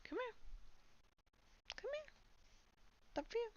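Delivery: very high, soft squeaky coo. Real, not acted. Streamer coaxing her cat up, very high soft squeaky coo. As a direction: squeak it softly up high like to a kitten.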